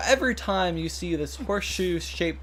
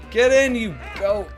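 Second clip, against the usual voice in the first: yelling; 8 semitones higher, 6 dB louder than usual. Real, not acted. Yelling at goats in game.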